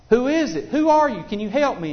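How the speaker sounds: high, loud, urgent pleading; desperate hiker. Retold or imitated. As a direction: call up desperately to the voice.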